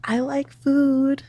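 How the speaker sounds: high, slow, drawn-out whine; I like food, sung out high and slow; whiny defiance. Real, not acted. Caller defends spending on food.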